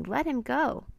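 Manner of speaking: high, mild storybook scream; screamed plea. A narrator's reading. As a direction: beg in a high voice. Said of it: "screamed Annie".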